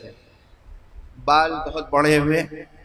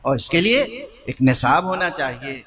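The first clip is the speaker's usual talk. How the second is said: ordinary talk, nothing marked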